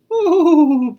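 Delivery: high, loud startled cry. Acted. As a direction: jump with fright on the oh. Lamb startled by coyote.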